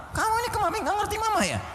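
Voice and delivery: high, fast scolding mother voice. Retold or imitated. Mother scolding her child who did wrong, high fast nagging. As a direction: snap at the child like a cross mum. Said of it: "kita marah".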